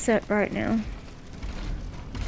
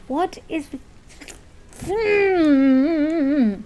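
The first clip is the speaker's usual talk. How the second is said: ordinary talk, nothing marked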